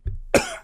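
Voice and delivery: sharp choking cough; coughing fit. Real, not acted. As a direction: cough hard, caught in the throat. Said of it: "Choking a little bit here on the show".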